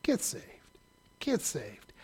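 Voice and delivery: hushed, tender divine call to be saved; soft pleading God. Pretend. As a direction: plead softly like a patient God. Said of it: "God is up there saying".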